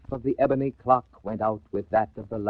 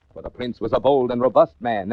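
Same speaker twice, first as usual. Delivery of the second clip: ordinary delivery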